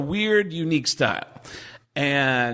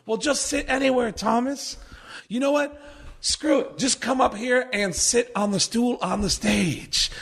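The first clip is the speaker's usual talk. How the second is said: imitating Mitch; 10 semitones higher than usual. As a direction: invite him up with easy stoner cool. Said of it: "he goes".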